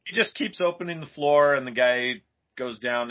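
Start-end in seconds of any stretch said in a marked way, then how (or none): none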